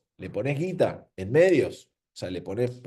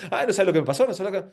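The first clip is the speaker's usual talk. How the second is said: mock chatterbox; 6 semitones higher, 3 dB louder, faster than usual. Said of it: "hablando y gritando y decir".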